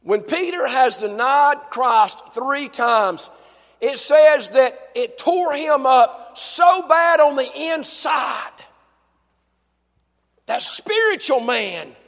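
slow, loud and rising preacher's cry; preaching at full force. Acted. Preacher crying out Peter's anguish, loud and rising. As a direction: build it up to a shout.

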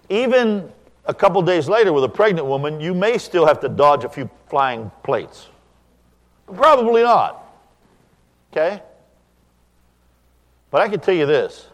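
loud, high preaching to the congregation; full-force preaching. Acted. Preacher warning the congregation about lying, in a loud, high preaching voice.

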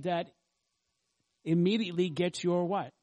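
usual speaking voice

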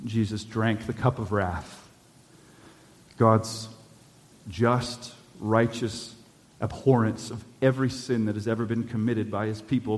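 usual speaking voice

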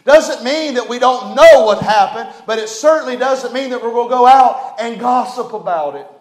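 normal talking voice

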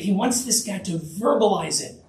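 usual speaking voice

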